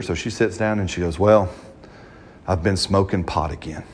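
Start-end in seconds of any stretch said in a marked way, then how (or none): 1.2-3.8 low, slightly downcast retold confession; beat down. Girl confessing to her pastors, beat down. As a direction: imitate someone weighed down, voice dropping. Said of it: "just beat down, weighed down".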